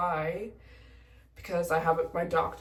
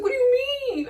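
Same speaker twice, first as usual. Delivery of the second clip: high, loud incredulous question; 9 dB louder, much faster than usual. Retold or imitated. Pilot questioning the lone traveler, incredulous. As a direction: blurt it high in disbelief. Pilot's baffled reply retold.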